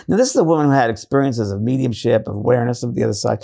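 normal talking voice

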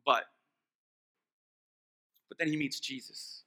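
low, soft, reflective sermon delivery; hushed preaching. Real, not acted. Preacher to congregation on meeting Jesus, hushed and solemn. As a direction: drop right down and let it sink in.

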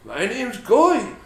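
slow, high goofy voice; lightly voiced goofy guy; silly voice. Mock introduction as Guy.

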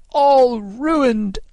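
high, drawn-out wail; anguished cry. A narrator's reading. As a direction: wail it in despair, leaning on ALL. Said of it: "he cried in anguish".

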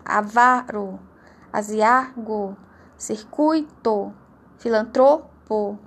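"Avaro, pudico, rubrica" slowly, with a long loud next-to-last syllable; tônica na penúltima sílaba. Shown as a demonstration. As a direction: lean hard on the penultimate syllable of "filantropo, ibero, rubrica". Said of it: "a sílaba tônica é a penúltima". ("Avaro, pudico, rubrica" devagar, com força na penúltima sílaba)

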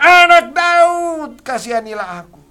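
very high, very loud drawn-out cry. Retold or imitated. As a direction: stretch the plea out as a desperate scream. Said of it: "dengan suara yang lebih keras lagi berteriak".

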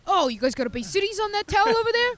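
put-on quick, high New York accent for a seagull; a seagull eyeing a towel in a New York accent. S1 copies the seagull bit, fast in a New York accent.

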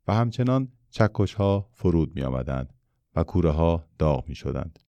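ordinary delivery

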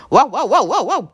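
high loud yapping wow wow; puppy barking. A narrator's reading. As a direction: yap like a little puppy. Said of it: "ugatott a kutyus".

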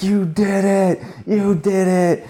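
high, slow joyful cheering; excited yell. Retold or imitated. Sponsor cheering his dancers from the front row. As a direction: cheer it out, bursting with pride. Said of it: "he's like".

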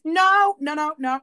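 sudden high loud no's, then settling; loud mock no. Mocking a nosy audience, loud high refusal. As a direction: cut them off loudly. Mock rebuke of oversharing couples.

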